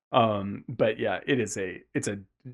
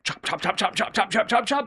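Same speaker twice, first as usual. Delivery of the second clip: a playful imitation of a blade, pitch rising higher and higher; mock chopping noises; 9 semitones higher than usual. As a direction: chop chop chanted, climbing higher each time. Imitating a fighter's relentless chopping.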